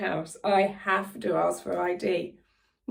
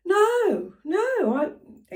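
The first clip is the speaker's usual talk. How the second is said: high-pitched mock American woman; light American accent, protesting higher; imitated American accent; 11 semitones higher, 5 dB louder, much slower than usual. Quoting the caller's denial, imitated American accent. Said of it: "again, in an American accent".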